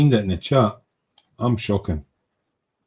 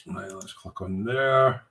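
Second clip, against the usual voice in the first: usual speaking voice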